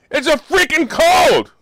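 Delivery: loud slow exasperated exclamation; exasperated outburst. Real, not acted. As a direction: blurt it out fed up. Repeating rant that Omicron is a cold.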